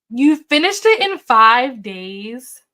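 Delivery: loud, slow disbelieving question; astonished. Real, not acted. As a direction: draw it out in real amazement. Amazed by a viewer's reading speed.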